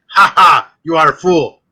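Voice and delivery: loud mocking automaton taunt; loud, stagy "ha ha" mockery; Zoltar. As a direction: gloat like a fortune-telling machine. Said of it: "put a dollar in and then go".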